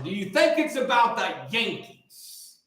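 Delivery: drawn-out rhetorical question; slow, deliberate challenge; slow pointed question. Real, not acted. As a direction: stretch it out to make the point. Repeats question slowly for emphasis.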